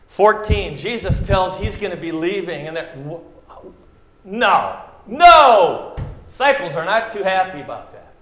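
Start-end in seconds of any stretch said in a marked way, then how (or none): none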